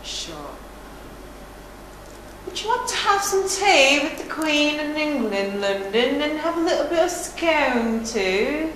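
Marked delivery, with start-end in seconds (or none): 2.5-8.7 higher-pitched, put-on British accent; tea-with-the-Queen British accent. Shown as a demonstration. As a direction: play at British, lilting up and down. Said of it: "tell us something in a British accent".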